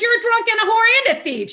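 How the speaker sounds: loud, high-pitched comeback; scolding retort. Retold or imitated. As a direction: fire the accusation right back as the sponsor. Sponsor firmly fires back.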